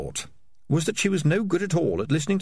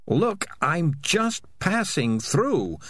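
desperate whisper; 6 semitones higher, much slower than usual. Acted. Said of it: "Morris whispered desperately".

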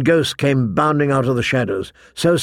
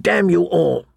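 higher-pitched, more forceful damn; firmer curse; 4 semitones higher than usual. A narrator's reading. John cursing all the boys, firmer curse. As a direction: widen the curse to everyone, pitched up.

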